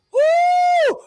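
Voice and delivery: high whoop of joy; excited whoop. Real, not acted. Host hyping her audience about Wendy news, high whoop. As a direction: let out a loud celebratory woo.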